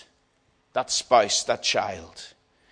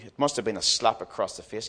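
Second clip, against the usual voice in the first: usual speaking voice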